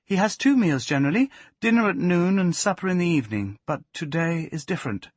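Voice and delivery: high woman's character voice, explaining; woman character, matter-of-fact. A narrator's reading. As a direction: pitch it up for her casual remark.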